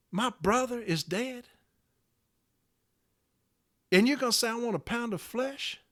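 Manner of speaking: soft, pained questioning; hushed disbelief. Real, not acted. Member to union leader about his dead brother, hushed disbelief. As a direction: pull the volume down, let it rise in disbelief.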